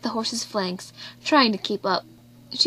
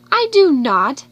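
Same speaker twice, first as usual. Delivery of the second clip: firm, louder refusal; decided; 4 semitones higher, 7 dB louder, slower than usual. A narrator's reading. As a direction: plant your feet and refuse. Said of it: "said Emily decidedly".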